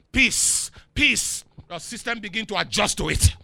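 loud, driving preacher's delivery; full-force preaching. Real, not acted.

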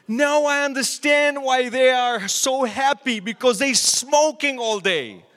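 loud and high, shouting his big discovery; loud, thrilled eureka; triumphant discovery. Retold or imitated. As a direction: announce it like you've cracked the case. City boy's big discovery.

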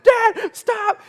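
high, loud, terrified yelp; shrill retold cry of fear; panicked scream for dad. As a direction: relive the kid's panic as he yells at his dad to stop. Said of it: "I'm screaming, I'm crying".

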